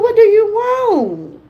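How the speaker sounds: high, slow exasperated question; mock weary plea to a picky toddler; exasperated grandma. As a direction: throw up your hands at the fussy child. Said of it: "I said".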